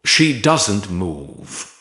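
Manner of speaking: ordinary delivery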